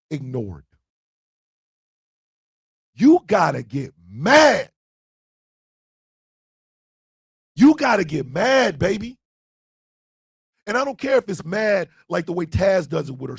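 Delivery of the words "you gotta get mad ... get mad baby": forceful urging. Real, not acted. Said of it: "urging listeners to get angry".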